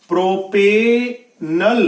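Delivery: प्रोपेनल very slowly, pro... pe... nal; syllable-by-syllable pronunciation. Shown as a demonstration. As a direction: stretch प्रोपेनल apart, ending in a short-vowel 'nal'. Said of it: "नाल नहीं कहना". (प्रोपेनल, धीरे-धीरे तोड़कर: प्रो-पे-नल)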